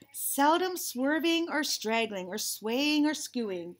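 slow, measured read-aloud; unhurried storybook reading; picture-book narration. Acted. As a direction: read slowly to children, savoring each alliterative word.

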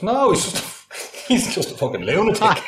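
high-pitched quote breaking into laughter; incredulous quote through laughs; laughing retort. Retold or imitated. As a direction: crack up while quoting your friend. Friend's retort, teller cracking up.

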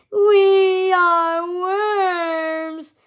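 loud, high, very drawn-out wail; grovelling minions. A narrator's reading. Pain and Panic grovel as worms, wailing. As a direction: wail it abjectly, stretching every word. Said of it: "they groveled".

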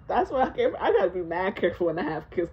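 ordinary talk, nothing marked

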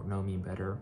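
normal talking voice

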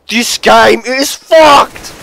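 real gamer rage; furious outburst. Real, not acted. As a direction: drag the words out in a raging shout. Rages at the game.

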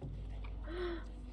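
soft, drawn-out gasp; long quiet intake of breath. Real, not acted. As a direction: suck in breath in alarm. Tense moment in game.

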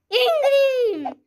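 high laughing squeal; excited squeal. Real, not acted. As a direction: squeal the name with a giggle. Child squeals name.

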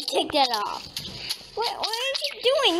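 normal talking voice

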